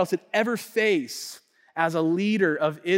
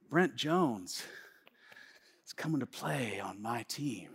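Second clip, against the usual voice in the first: low, breathy-soft murmur of disbelief; a soft, awed aside; hushed awe; 8 semitones lower, 13 dB quieter than usual. Retold or imitated. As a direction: say it quietly like you can't believe your luck. Awed inner thought about teammate.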